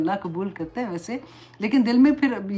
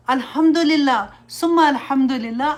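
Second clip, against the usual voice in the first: fervent thanks; 4 semitones higher, 5 dB louder, much slower than usual. Real, not acted. Thanking Allah with fervour.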